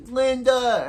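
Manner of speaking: high, loud cry of her friend's name; calling out. Retold or imitated. Iris calling out to Linda as Zoom grabs her, loud cry. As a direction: shout her name as she's taken.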